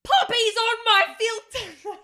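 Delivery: high, drawn-out comic yell; mock outraged shout. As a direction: holler it slowly, half laughing. Silly riff on pop filter.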